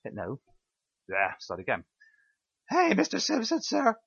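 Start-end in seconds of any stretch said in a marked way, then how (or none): 2.7-4.0 high, scared prisoner voice; timid high squeak to the guard; frightened inmate. Acted. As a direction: plead up high like a cornered inmate. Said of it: "frightened inmate number two".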